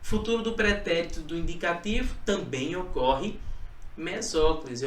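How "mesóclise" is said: ordinary talk, nothing marked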